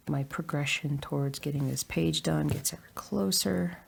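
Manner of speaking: usual speaking voice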